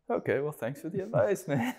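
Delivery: a lightly mock polite brush-off; dismissive thanks. Window washer brushes off the driver's pricing tip, light mock thanks. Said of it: "It's like".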